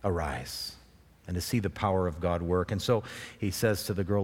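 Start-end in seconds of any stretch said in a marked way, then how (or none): 0.0-0.8 low, soft divine command; hushed, deep word from God; voice of the Lord. Acted. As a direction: drop low and quiet, as the Lord speaking. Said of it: "hear the voice of the Lord say".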